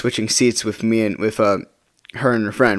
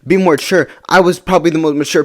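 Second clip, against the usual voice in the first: mildly indignant, higher-pitched retort; retold disbelief; 6 semitones higher than usual. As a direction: replay your disbelief at being blamed. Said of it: "I couldn't believe what I was seeing".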